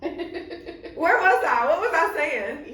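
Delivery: high, loud, slow and laughing; laughing at herself. Real, not acted. Having lost her train of thought, asking her friend while laughing.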